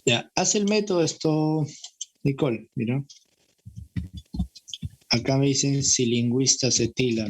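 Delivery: ordinary delivery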